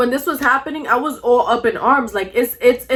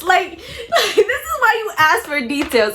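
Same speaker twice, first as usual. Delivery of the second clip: higher-pitched, laughing through the words; 7 semitones higher than usual. Real, not acted. Woman on catching her boyfriend's lie, laughing. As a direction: let real laughter break into the point.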